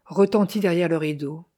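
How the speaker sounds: ordinary delivery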